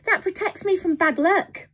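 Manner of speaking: high, loud panicked protest; frightened cry. Acted. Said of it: "he cried".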